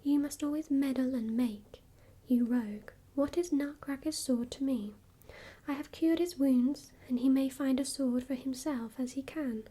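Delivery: usual speaking voice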